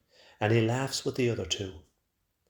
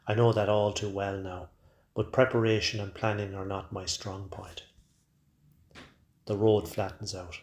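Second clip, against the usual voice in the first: plain reading voice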